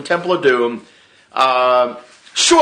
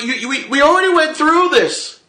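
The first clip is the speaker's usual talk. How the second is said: much higher, strained and exasperated; exasperated whine. Retold or imitated. Fan protesting to the writers in a high exasperated whine. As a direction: plead like you can't take it twice. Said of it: "And I'm like".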